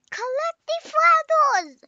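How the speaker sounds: high, loud call to go collect; excited shout. Real, not acted. Kid shouting at the game to grab flowers, loud and high. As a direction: yell it out like a game order.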